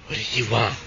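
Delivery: low, soft eerie ghost voice. Retold or imitated. Ghost girl asking the boy what he wants. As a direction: drop low and menacing as the ghost. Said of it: "And she said".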